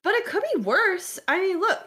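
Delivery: high-pitched cellmate talking up prison life; boastful cellmate. Acted.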